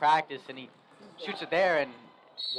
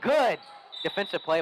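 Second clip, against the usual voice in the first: excited call; 6 semitones higher, 4 dB louder than usual. Real, not acted. Live play call, good defense.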